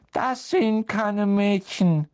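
slow, high-pitched wailing ghost voice; moping ghost girl. A narrator's reading. Ghost challenges intruders in her girls' toilet, high slow sulky voice.

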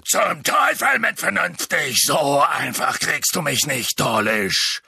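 loud, rough defiant bellow; roaring. A narrator's reading. Hagrid defying the wizard attacking him, roaring. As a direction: roar back at the attackers. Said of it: "Hagrid brüllte".

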